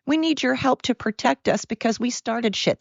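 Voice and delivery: high, whiny begging voice; mock them begging for help; mock pleading whine. Mocking weak countries begging America for help, high whine. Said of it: "And they're like".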